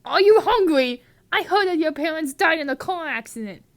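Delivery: loud, high angry shouting at her; outraged shout at Petunia. Acted. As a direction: yell it, furious with her. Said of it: "Angry at Petunia".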